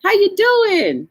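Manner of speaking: high, slow, drawn-out greeting; Miss Yo-Yo's hello. Retold or imitated. Imitating a viewer's greeting to everyone, high and slow. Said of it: "She said hello to everybody".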